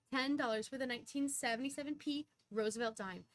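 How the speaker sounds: ordinary talk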